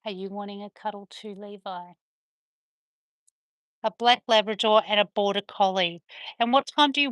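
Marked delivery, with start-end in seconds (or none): 0.1-1.9 soft murmur to a dog; tender to pet. Real, not acted. Owner asks dog about a cuddle, tender and soft. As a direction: speak softly and fondly, just for the dog.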